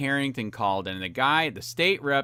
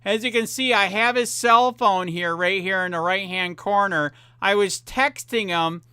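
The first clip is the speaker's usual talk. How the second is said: still heated; 5 semitones higher than usual. Real, not acted. Still heated, showing the texts.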